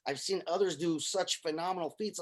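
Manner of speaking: ordinary talk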